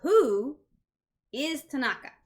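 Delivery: "Who" punched loud and high, then "is Tanaka?" falling; stress on who. Shown as a demonstration. Teacher contrasting two sentences, stressing "Who". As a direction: hit "Who" hard, like the real question word. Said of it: "The second one".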